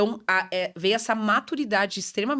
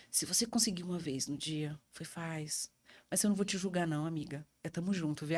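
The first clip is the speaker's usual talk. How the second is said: reassuring; 2 semitones lower, 9 dB quieter than usual. Retold or imitated. Reassuring mothers as doctor.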